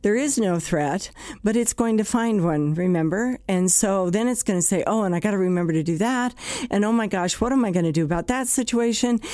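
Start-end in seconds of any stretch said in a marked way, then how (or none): 4.9-9.2 fast, lightly worried chain of thoughts; spiralling anxious self-talk. Retold or imitated. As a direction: pile worry on worry like a racing mind.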